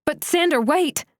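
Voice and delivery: high urgent call after him; shouting. Acted. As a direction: cry out for him to stop. Calling after running man.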